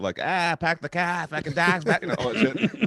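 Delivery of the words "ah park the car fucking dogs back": mock a friend's East Coast accent. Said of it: "from the East Coast that's got".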